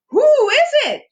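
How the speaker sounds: high startled owl voice. A narrator's reading. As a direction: woken owl calling out. Owl voiced in read story.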